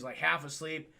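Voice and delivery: usual speaking voice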